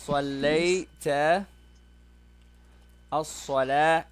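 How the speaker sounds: slow, drawn-out teacher model; slow model question. Shown as a demonstration. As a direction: spell it out slowly for the student. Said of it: "I'll say to you".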